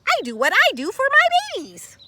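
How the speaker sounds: high, fast mother rabbit chatter. Acted. Rabbit mother answering Carl, high and fast. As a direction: rattle it off like a busy mother. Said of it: "she said".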